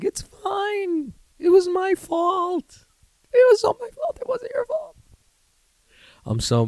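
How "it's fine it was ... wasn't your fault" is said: very high falsetto, mock meltdown; squeaky appeasing. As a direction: placate mom in a tiny squeaked voice. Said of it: "My mom's yelling at me. I'm like".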